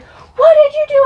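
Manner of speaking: very high, loud mock mom; acted shrieked demand. Mom confronting her child, shrieked demand. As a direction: demand an answer at full pitch.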